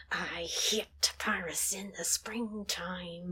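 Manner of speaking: slow, breathy whispered mutter; under her breath. Retold or imitated. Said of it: "under her breath".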